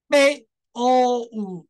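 slow and deliberate O U split; slow syllable demo. Shown as a demonstration. Teacher slowly splitting the sounds for the class. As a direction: say 背O五 slowly, each sound apart. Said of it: "父是这样".

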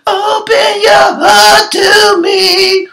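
very high, slow, loud mocking sing-song. Mocking [name] as she interrupts [name], high diva wail. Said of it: "interrupts her with".

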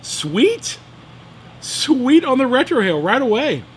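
delighted at the first puff of the cigar. Real, not acted. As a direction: savor it out loud, thrilled.

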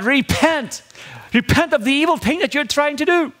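high, ringing prophet's cry to God; commanding Moses. Acted. Moses rebuking God in sermon.

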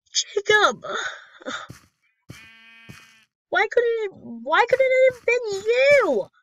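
very high, loud, drawn-out wailing; hammed-up anguish. Player lamenting that it had to be Jacob, melodramatic wailing. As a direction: wail in mock despair over a lost friend. Mourns infected best friend in game.

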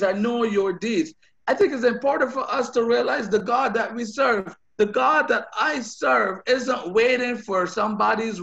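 loud, driving preacher's exhortation; preaching at full force. Acted. Pastor urging the congregation on, loud and driving. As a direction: build it up and press it on the congregation.

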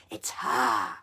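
low, loud growl on "It's her"; growled like dogs. Acted. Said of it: "the witches growled like dogs".

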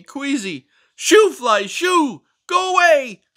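high, loud indignant scolding voice; fussy book character. Acted. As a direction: scold the reader for snacking. Character shooing flies.

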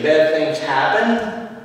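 ordinary talk, nothing marked

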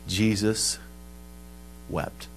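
soft, low, solemn quotation; quiet and reverent, pause between words; hushed verse. Retold or imitated. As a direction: drop to a hush, word by word. Quotes the verse after loud preaching.

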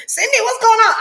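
high, loud startled cry of alarm; startled friend. Acted. A friend yelps at Cindy in high-pitched alarm. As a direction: yelp it out like a panicked friend.

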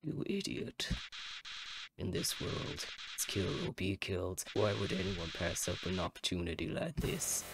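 quiet, sinister villain voice; menacing flower. Acted. Talking flower turning on the player, hushed menace. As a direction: drop to a soft, breath-heavy menace.

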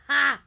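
high, very loud single "Ha!"; loud surprised laugh. Acted. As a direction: blurt it out. Dot's burst of surprise.

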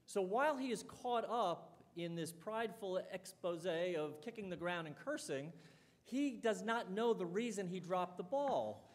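ordinary talk

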